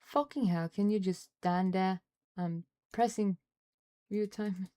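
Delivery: loud exasperated shout at the screen; frustrated yelling. Real, not acted. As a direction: yell it out, fed up. Yelling at uncooperative software.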